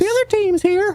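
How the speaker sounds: squeaky high warning; eager towel boy. Pretend. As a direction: pipe it up, anxious and tiny. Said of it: "chubby water or towel boy".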